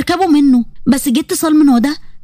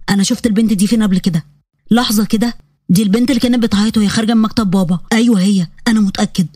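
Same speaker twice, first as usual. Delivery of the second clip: put-on lower, easygoing young man brushing off an apology; Yazan's calm male voice; 5 semitones lower than usual. Yazan apologizing to Huda after bumping her, low and easy. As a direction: drop into a low relaxed male voice.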